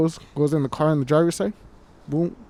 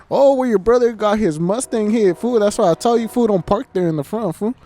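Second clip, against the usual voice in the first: higher, louder mock scolding; imitated scolding relative; 6 semitones higher, 3 dB louder than usual. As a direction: lightly mimic a relative scolding about parking in front. Retold relative scolding about the parked car.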